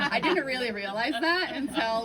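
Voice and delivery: loud, high, laughter spilling into the words; laughing. Real, not acted. Speaker admitting surprise about badass librarians to audience, laughing.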